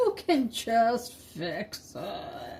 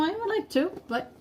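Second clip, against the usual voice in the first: normal talking voice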